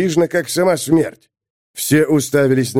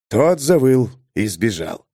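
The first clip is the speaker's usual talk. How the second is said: usual speaking voice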